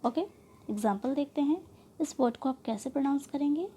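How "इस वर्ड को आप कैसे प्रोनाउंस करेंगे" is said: usual speaking voice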